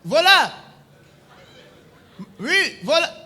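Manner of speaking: hammed-up triumph; triumphant voilà. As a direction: cry out in victory. Operator's triumphant exclamation.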